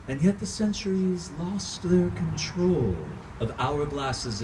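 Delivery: impassioned theatrical recitation swinging from hush to outcry; dramatic monologue. Acted. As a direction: pour it out like a tormented vampire mourning his wife. Performs own Dracula monologue.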